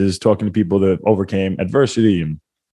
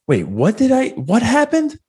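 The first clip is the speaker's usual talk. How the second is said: much higher, lost, asking all over; dazed confusion; slower than usual. Retold or imitated. Friend mimicking the knocked-out fighter asking again, high dazed confusion. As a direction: sound like he's forgotten everything at once. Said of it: "literally right from that to".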